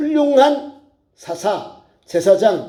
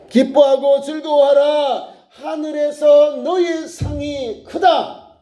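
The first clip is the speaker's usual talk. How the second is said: slow, higher declaiming voice; scripture proclamation; 8 semitones higher, much slower than usual. Acted. Preacher quoting the promise of reward to the congregation, slow proclamation. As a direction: proclaim the verse slowly and high.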